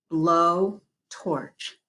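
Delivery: "Blow torch" said as two separate parts; split into syllables. Shown as a demonstration. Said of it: "Two syllables".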